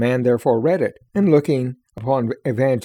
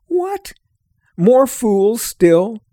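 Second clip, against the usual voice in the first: sharp, much higher what, then slow scoffing question; scornful disbelief; 7 semitones higher, much slower than usual. Acted. Obstinate mocks Pliable for wanting to follow. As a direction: scoff in disbelief that another is fooled.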